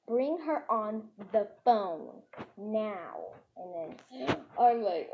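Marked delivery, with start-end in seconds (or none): 0.1-3.3 slow, weighty parental order; acted stern demand. Parents demanding daughter on the phone, acted stern demand. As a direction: space out each word as an angry parent's order.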